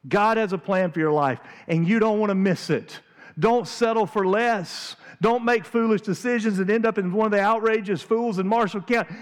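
loud, high, pleading with the whole room; a preacher shouting his plea to every listener; urgent pleading shout. Pretend. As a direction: grab them by the face and shout it home. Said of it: "Grab you by the face and go".

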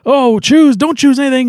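panicked heroes. Retold or imitated. The team realizing what choosing would mean, alarmed. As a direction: raise the voice to warn everyone off. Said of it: "then they're like".

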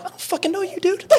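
retorting to the gunman in high-pitched disbelief; incredulous outburst. Retold or imitated. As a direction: squeak out stunned disbelief at him. Retold panicked reply to gunman.

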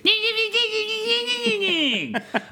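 long high squealing laugh-cry; bee voice squeal. Retold or imitated. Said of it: "and I'll do Bumblebee's voice".

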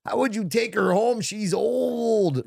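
high-pitched movie line; imitating the Happy Gilmore line; movie quote impression.

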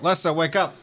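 high-pitched mock shout; shouting to wake someone. Player rousing sleeping Lessa in a game, high acted shout. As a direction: call out urgently, high, without real volume. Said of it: "and I shout".

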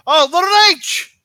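high, loud excited call; wrestling commentator call. Pretend. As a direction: shout the name like a commentator.

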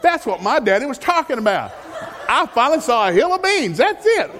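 high, loud and laughing through it; laughing excitedly. Retold or imitated. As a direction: crack up as the joke lands. Delighted at seeing hill of beans.

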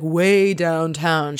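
drawn-out, put-on "waaay"; exaggerated slow drawl in mockery; mock vague answer. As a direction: stretch it slowly, imitating an evasive reply. Mocking Kelly's evasive answer.

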